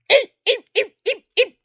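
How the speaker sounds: very high squeaky eep yips; squeaky little dog yips. Retold or imitated. Husband mimics wife as a tiny yipping dog. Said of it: "She's going".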